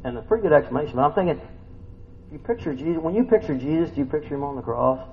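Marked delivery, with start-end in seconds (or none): none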